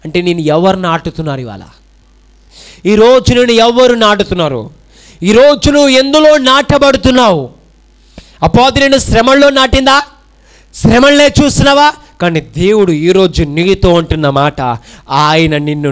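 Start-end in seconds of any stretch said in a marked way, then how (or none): 2.9-11.9 shouted, high-pitched sermon lines; full-force preaching. Acted. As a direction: preach it out with fire to the crowd. Preaching climax. (పూర్తి ఉత్సాహంతో బోధన)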